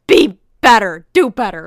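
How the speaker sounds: loud, emphatic telling-off; mad scolding. Retold or imitated. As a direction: burst out at a rude stranger. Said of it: "just made me mad".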